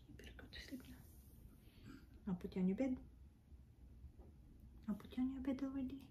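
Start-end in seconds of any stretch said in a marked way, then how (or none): 0.1-2.0 very soft unvoiced whisper; gentle hushed bedtime order. Real, not acted. Owner whispering the dog off to sleep.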